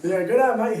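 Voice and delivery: loud, high mock Australian greeting; hammed-up Australian accent; Aussie accent. Shown as a demonstration. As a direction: boom out a jokey Aussie g'day. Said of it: "How do you do a Aussie accent?".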